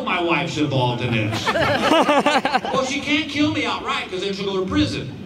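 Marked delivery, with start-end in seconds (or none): 1.5-2.7 loud laughing burst. Real, not acted. Laughs at his own joke.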